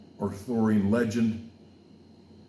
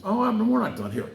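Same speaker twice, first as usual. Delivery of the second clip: calling out; 11 semitones higher, 4 dB louder than usual. Real, not acted. Calling out to stop leaving.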